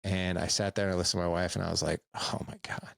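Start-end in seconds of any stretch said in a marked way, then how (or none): 2.1-2.9 breathless whispered "oh my God". Retold or imitated. Husband realizing his mistake to his wife, whispered. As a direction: barely breathe it out, stunned. Stunned reply to wife's feedback.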